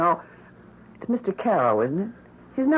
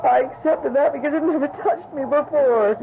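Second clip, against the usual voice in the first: anguished protest; 9 semitones higher than usual. Acted. Grieving mother rejects husband's comfort.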